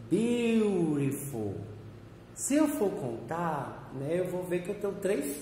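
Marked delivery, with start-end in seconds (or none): 0.1-1.6 beautiful said slowly and carefully, syllable by syllable; slow model pronunciation. Shown as a demonstration. Teacher models beautiful slowly for the class. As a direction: stretch out beautiful so students can copy it. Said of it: "Por exemplo".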